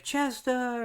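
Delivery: high, alarmed call of his name; frightened cry of his name. Retold or imitated. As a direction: call out to him in shock as he is hit. Connie screams as fiancé shot.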